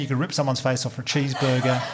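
usual speaking voice

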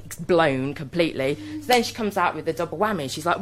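ordinary talk, nothing marked

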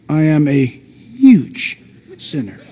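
low, slow inner confession; secret confession. Retold or imitated. Voicing a hidden inner confession.

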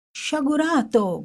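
put-on young man cries out at the burning money; crying out. A narrator's reading. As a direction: shout him down as the notes burn. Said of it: "gridò".

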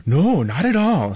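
high, slow, drawn-out reply; hammed-up little shopman, read for kids; storybook shopkeeper. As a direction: stretch it out in a swooping high voice. Voices shopkeeper in children's book.

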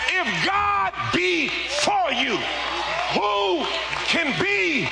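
high, loud, drawn-out sermon cry; full-force preaching. Real, not acted. Preacher rousing the congregation about God's favor, full-force preaching.

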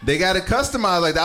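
ordinary talk

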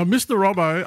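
usual speaking voice

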